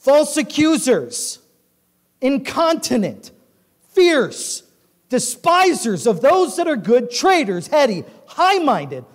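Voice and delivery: high, punched list, word by word; emphatic scripture reading. A narrator's reading. Preacher reads sins to congregation, emphatic. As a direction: hammer out each sin separately.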